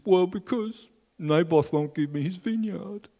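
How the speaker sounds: high, whiny voice of a sulking king; Ahab sulking. Acted. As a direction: whine it like a spoilt child. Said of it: "And he says".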